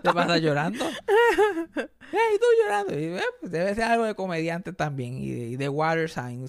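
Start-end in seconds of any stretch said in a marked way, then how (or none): 0.9-3.0 high, mocking taunt; teasing girlfriend. The girlfriend mocking the crying comedian, high-pitched. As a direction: jeer at him in a high voice. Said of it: "la novia se pasa bulleando".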